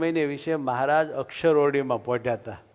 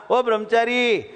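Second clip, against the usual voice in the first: put-on high, louder call of an old woman; 9 semitones higher, 4 dB louder than usual. Old Ganga calling out to the brahmachari. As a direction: hail him from a distance.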